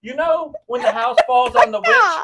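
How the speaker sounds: high, loud, laughing disgust; laughing squeal. Real, not acted. Telling cohost about clammy toes, laughing squeal.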